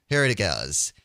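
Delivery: ordinary talk, nothing marked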